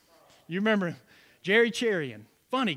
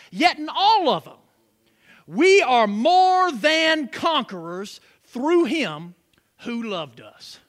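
slow, loud, higher preaching of the verse; 7 semitones higher, 7 dB louder, slower than usual. Acted. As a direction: proclaim each phrase to the congregation, slowly. Preaches the verse at full force.

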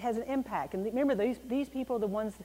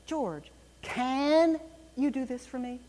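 "can" drawn out long after "George"; slow, heavily held "can", then the rest plainly; stress on can; 3 semitones higher, slower than usual. Shown as a demonstration. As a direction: stretch the word "can" for emphasis. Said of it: "But what if I said".

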